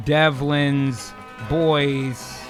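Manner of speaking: ordinary talk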